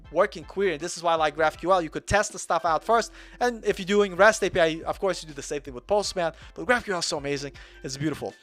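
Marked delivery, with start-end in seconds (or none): none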